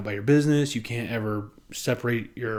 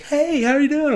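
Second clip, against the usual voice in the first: much higher and louder mock cheery hello; chirpy greeting; 7 dB louder than usual. Imitating the cheerful office lady greeting an exhausted worker, high and chirpy. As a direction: sing it out like an overly bubbly coworker.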